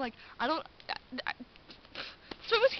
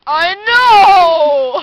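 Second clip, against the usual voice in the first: huge, drawn-out, high shout; mock exclaiming yell; 4 semitones higher, 23 dB louder than usual. Imitating Lucas's outburst about therapy, hammed-up yell. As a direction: bellow it, stretching the last word.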